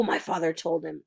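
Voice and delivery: ordinary delivery, nothing marked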